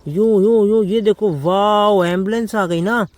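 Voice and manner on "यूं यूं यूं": loud playful engine vroom; vroom noises. Acted. Driving the toy police car for the kids, loud vroom. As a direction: zoom the toy car along. Ambulance arriving.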